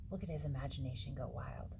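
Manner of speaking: usual speaking voice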